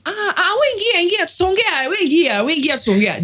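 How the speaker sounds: imitated cab guy. Cab driver urging a passenger to get in, imitated cajoling. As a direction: play a cabbie brushing off the haggling. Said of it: "Guy says".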